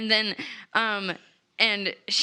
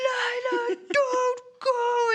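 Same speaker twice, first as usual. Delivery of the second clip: much higher, hammed-up crying plea; mock pleading cry; 12 semitones higher, much slower than usual. Boudreaux begging Lila to stay, mock sobbing. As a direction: wail her name like a hammed-up soap scene.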